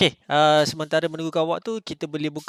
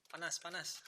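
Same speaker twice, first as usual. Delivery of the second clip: muffled robotic helmet voice filter; Iron Man voice; 4 semitones higher, 19 dB quieter than usual. Real, not acted. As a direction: voice through a sealed mask effect. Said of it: "Okey, back to normal".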